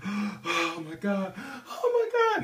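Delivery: very high, slow, loud cries mixing laughs and sobs; half laugh, half cry, soaring up; overjoyed crying out. Retold or imitated. Teammates laughing and crying after the comeback win, overjoyed.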